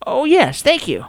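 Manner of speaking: happy to be offered tea; grateful old man. Acted.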